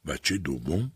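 low, soft question, as if seeing a ghost; stunned echo. A narrator's reading. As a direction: repeat it in quiet shock, rising at the end. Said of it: "مثل آن که به شبحی نگاه کند".

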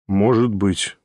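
low, short reply; curt Nikolai. A narrator's reading. Nikolai answering the chattering guest briefly, low. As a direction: drop it curtly.

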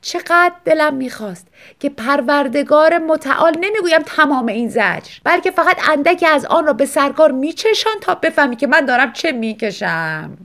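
wife moaning reproachfully. A narrator's reading. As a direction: whine out her suffering. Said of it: "زن ناله کنان جواب داد".

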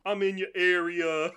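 loud, slow mock rap line; imitating the singer. Quoting Death Grips to laughing friends, loud slow imitation. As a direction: draw it out loud like the track. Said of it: "just the way he said".

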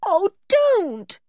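high, pleading wail; tearful, high-pitched protest; almost in tears. A narrator's reading. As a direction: plead as if about to cry. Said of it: "almost in tears".

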